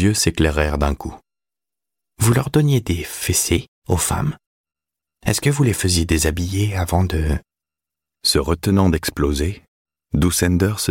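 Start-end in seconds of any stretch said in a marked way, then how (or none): 2.2-7.4 put-on higher boyish voice, avid curiosity; teenage boy, eager. A narrator's reading. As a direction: press the old man with eager questions. (garçon avide de savoir, voix plus aiguë)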